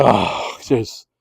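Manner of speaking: low, soft, deflated; resigned sigh. Retold or imitated. Coach giving up and heading back, low and deflated. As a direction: let it sink out of you. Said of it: "he's like".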